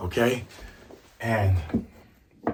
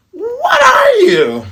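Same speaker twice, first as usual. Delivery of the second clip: very high, loud, stretched scream; mock shocked shriek; 6 dB louder, much slower than usual. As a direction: drag it out in a piercing screech. Voices Nami, shocked at Luffy.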